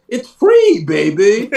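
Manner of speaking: high, loud mock British squeal; grandma's showy fake British voice; fake British accent. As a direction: put on a hammy British accent. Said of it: "put on a fake British accent".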